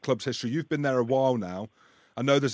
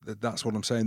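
ordinary delivery

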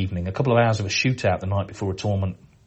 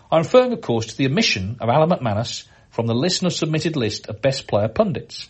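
higher and louder, hamming up the outrage; mock-grave reading; 3 semitones higher, 3 dB louder than usual. Host reads a fan's mock outrage letter, hammed-up grave reading. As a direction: read it like a solemn scandal, tongue in cheek.

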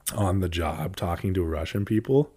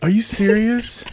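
loud, high-pitched disbelief; incredulous; 14 semitones higher, 6 dB louder than usual. Real, not acted. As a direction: blurt it out in real amazement. Real disbelief at friend's story.

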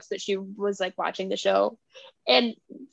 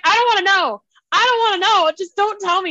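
higher, louder mock protest; horrified refusal; 7 semitones higher, 5 dB louder than usual. Dad cuts off daughter's explanation, horrified refusal. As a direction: imitate a dad shutting the subject down.

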